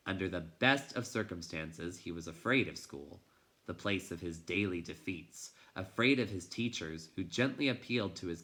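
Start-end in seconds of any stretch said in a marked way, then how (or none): none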